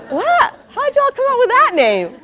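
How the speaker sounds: high, loud incredulous what; outraged disbelief at the nickname; indignant surprise. Retold or imitated. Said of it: "I'm like".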